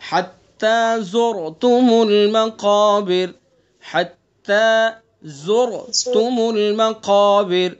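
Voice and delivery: very slow drawn-out chant; tajweed model for students; Quranic recitation. Shown as a demonstration. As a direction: recite the verse long and melodic. Teacher recites verse twice.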